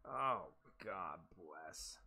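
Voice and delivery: resigned sigh. Real, not acted. Bad lie, resigned.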